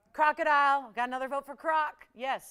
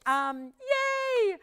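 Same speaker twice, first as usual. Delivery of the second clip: mock cheer; 11 semitones higher, 8 dB louder than usual. Real, not acted. Cheering a viewer's greeting.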